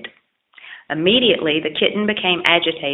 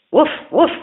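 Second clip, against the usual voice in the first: high, loud barking woof; dog bark; 9 semitones higher, 6 dB louder than usual. Acted. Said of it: "barked the dog".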